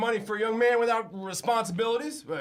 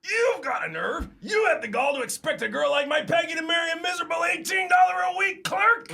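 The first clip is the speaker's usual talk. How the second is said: outraged shout; 8 semitones higher than usual. Acted. Furious boss yelling at his lowly clerk, outraged. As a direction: blast him for his nerve.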